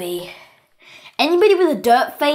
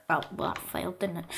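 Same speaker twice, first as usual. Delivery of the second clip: normal talking voice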